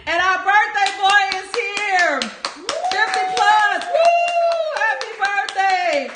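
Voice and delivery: loud, high, drawn-out whooping welcome; jubilant birthday cheer. Real, not acted. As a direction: hail the party's guest of honor. Birthday guest arrives on the live.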